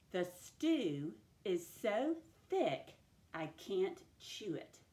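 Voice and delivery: slow, word by word with pauses; sentence dictation. Shown as a demonstration. Teacher giving a sentence to write together, slow dictation. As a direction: dictate it deliberately, separating each word. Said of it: "move on to sentence dictation".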